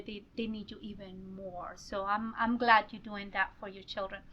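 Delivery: ordinary talk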